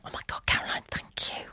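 breathless whisper of thanks; whispering thank you to her sister. Retold or imitated. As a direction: whisper it, overwhelmed with gratitude. Grateful reaction to sister's permission.

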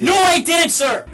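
high, insistent repeated protest; heated denial. Real, not acted. Suspect answering a detective's murder accusation, heated denial. Shouted back-and-forth with detective.

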